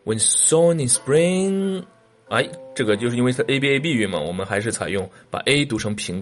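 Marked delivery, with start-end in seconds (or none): 0.0-1.8 sown in spring on a level, slow rising tune. Shown as a demonstration. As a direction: keep "when sown in spring" high and slow. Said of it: "可以给一个平调或者升调". (平调或升调朗读)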